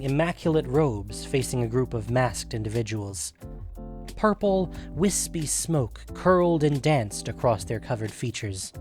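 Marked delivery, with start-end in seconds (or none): none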